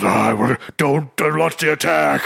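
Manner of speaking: hammed-up, slow superhero growl; mock an actor straining through an over-intense action line; Batman voice. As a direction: growl it out as a parody tough hero. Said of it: "he's doing his Batman voice".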